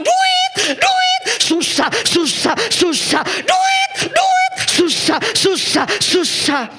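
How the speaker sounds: very high, loud shriek of money, money; shrieking wife. Retold or imitated. Husband imitates his wife shrieking about money, high and loud. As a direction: screech like a nagging wife.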